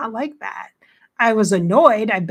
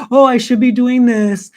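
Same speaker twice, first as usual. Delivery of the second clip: louder, higher mock guilt-trip voice; guilty self-talk; 4 semitones higher, 9 dB louder than usual. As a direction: voice the nagging inner critic.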